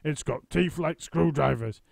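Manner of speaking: husband teasing in mock alarm; Mister Twit. A narrator's reading. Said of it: "Mister Twit said".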